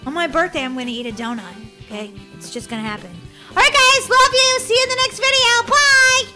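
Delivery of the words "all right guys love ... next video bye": loud, high cheery farewell; big sign-off. Real, not acted. Vlogger ending video to viewers, big sign-off. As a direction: call out goodbye to the audience.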